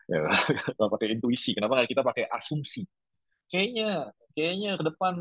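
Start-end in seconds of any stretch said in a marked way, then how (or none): 3.4-5.1 higher-pitched musing voice, playing someone guessing the future; guessing student. Teacher voices a student guessing future jobs, lifted musing tone. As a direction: toss out a hunch in a lifted, musing voice. Voicing an intuition-based guess.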